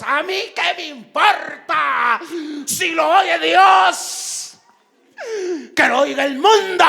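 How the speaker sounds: slow, high, drawn-out yell; furious shouting. Acted. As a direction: act out someone defiantly screaming for all to hear. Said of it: "ya está gritando". (grito furioso y desafiante, actuado)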